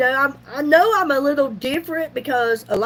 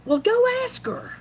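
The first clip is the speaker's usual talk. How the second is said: high-pitched imitation of a dad; imitated dad, raised pitch; dad's quote; 10 semitones higher than usual. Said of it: "I heard her dad say".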